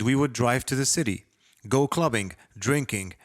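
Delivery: ordinary delivery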